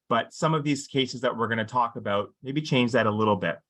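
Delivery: usual speaking voice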